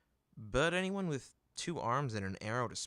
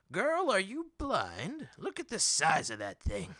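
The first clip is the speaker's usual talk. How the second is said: loud, higher, slow indignant disbelief; affronted merchant; 9 semitones higher, 7 dB louder than usual. Acted. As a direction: play offended at the insult to his beast.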